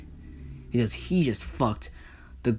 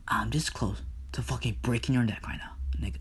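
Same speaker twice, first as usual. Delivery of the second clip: soft, whispery threat; menacing hush; 6 dB quieter than usual. Acted. Hades holds Persephone by the throat, hushed menace. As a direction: threaten under your breath, up close.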